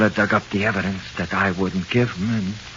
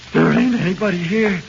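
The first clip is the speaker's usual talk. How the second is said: high, loud, shaky insistence; frightened denial; 11 semitones higher, 8 dB louder than usual. Acted. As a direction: insist in fear that nobody is there. Prisoner insists no ghost is there.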